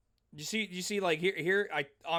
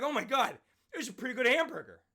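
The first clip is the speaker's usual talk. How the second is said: higher, quicker mock delight; mock satisfied stranger; 7 semitones higher than usual. Teacher voicing a fighter soothed by a hamburger, mock delight. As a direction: ham up a calmed stranger savoring food.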